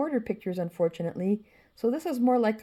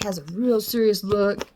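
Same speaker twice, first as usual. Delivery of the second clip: ordinary talk, nothing marked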